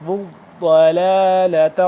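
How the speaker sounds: slow, loud ضلالۃ with a thick doubled dad; heavy stretched dad. Shown as a demonstration. Teacher demonstrating for learners, heavy held dad. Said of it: "ضاد موٹا بھی ہے اور تھوڑا سا".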